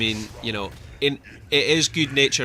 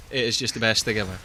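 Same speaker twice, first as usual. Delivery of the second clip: ordinary talk, nothing marked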